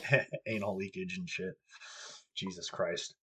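usual speaking voice

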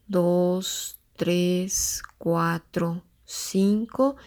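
slow, drawn-out counting of breaths. Shown as a demonstration. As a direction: count each breath slowly and calmly. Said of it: "te tomes cinco tiempos para inhalar".

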